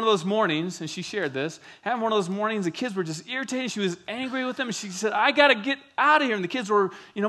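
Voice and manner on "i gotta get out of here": raised, strained voice, at the end of her rope; a frazzled mom who has to escape; exasperated mom. Retold or imitated. Mom snapping at her needy kids, exasperated and louder.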